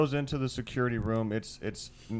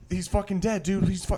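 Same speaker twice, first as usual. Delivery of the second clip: higher, louder frantic blurt; panicked report; 8 semitones higher, 3 dB louder than usual. Retold or imitated. Guy runs back to the girls, frantic and high. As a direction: gasp it out like you just saw a corpse. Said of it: "He's like".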